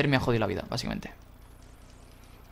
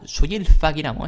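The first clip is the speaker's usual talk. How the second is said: ordinary talk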